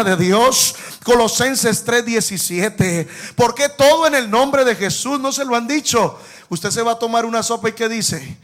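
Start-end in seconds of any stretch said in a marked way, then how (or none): none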